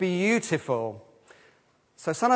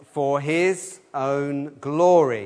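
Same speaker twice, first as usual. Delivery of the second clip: very slow, every word spaced for all to join; slow recitation; much slower than usual. Shown as a demonstration. Leading children and adults in saying it together, very slow. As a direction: lead the group, stretching each word. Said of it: "And the answer is".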